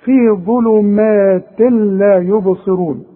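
slow recitation of ظلمات لا يبصرون, each letter given its due; careful tajweed model, thin meem in ظلمات; every letter articulated. Shown as a demonstration. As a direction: draw out ظلمات لا, tanween merged into the laam. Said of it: "ادي كل حرف حقه".

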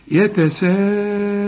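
"يتساءلون" with the long a before hamza held steadily; long madd. Shown as a demonstration. Teacher demonstrating obligatory madd, long held vowel. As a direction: stretch "سا" in "يتساءلون" on one note. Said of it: "لازم تمد".

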